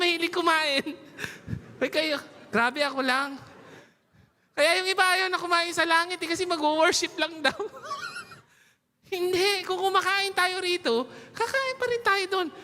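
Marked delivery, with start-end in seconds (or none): none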